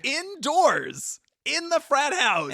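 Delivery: high, loud exclamation; outraged shout. Real, not acted. Co-host appalled at smoking indoors, outraged shout. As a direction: cry out in comic outrage, drawing it out.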